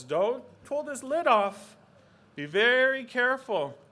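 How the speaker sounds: loud, high grandfatherly warning; stern warning. Acted. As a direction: warn the baby emphatically. Grandfather warns the baby.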